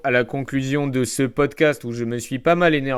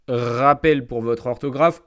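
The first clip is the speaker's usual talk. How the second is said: ordinary talk, nothing marked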